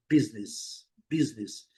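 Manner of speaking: "business" as "BIZ-nis", two syllables; English pronunciation. Shown as a demonstration. Teacher modelling the correct sound, English "business". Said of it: "Nada de business".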